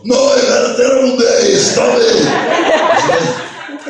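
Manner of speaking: very high, loud character shriek; insane impression. Girlfriend answering back at home, shrieking impression. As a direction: screech it back in a wild cartoon voice. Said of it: "she does these insane impressions".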